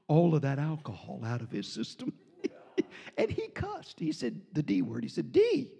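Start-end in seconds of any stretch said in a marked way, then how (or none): 5.3-5.7 amazed outburst. Retold or imitated. Drunk man suddenly sober.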